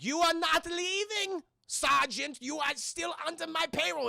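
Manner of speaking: hammed-up German mad scientist accent; evil German scientist accent. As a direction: sneer it as a cartoon German villain. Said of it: "stereotypical evil German Nazi scientist".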